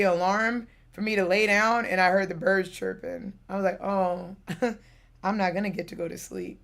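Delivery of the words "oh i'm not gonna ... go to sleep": soft, mildly crestfallen retelling; understated, subdued disappointment; deflated realization. Retold or imitated. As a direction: let it sink in quietly, resigned. Retelling her dismay at birdsong at dawn.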